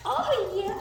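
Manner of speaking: very high, loud squeal to a dog; big high-pitched gushing; excited dog talk. Real, not acted. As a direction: hype the dog up for play. Hyping up dog with toy.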